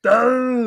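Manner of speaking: high, loud laughing growl; alien roar. Retold or imitated.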